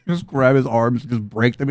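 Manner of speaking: ordinary talk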